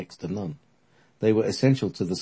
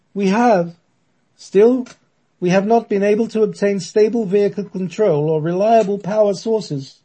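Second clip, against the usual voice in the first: put-on old lady medium; 13 semitones higher, 7 dB louder than usual. Medium answering the spirit.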